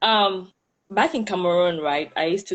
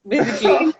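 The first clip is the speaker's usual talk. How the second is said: laughing; 10 semitones higher than usual. Real, not acted. Shy laugh after self-description.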